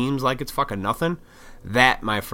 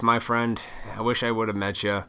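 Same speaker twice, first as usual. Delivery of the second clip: ordinary talk, nothing marked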